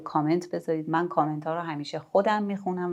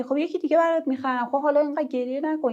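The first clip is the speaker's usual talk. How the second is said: much higher, impatient brush-off; dismissive parent; 9 semitones higher, 4 dB louder than usual. Shown as a demonstration. Parent brushing off child's broken toy, impatient. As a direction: hurry the child to stop crying.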